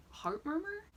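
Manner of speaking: high, rising puzzled query; softly baffled, pitch climbing high; puzzled question. Acted. Vlogger mock-guessing what "tick tick in your heart" means, puzzled. Baffled retort to a retold line.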